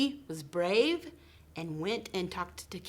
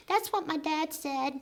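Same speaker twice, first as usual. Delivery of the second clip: squeaky puppet; 9 semitones higher than usual. Pretend. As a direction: play the eager little puppet pal. Puppet Whiskers speaks.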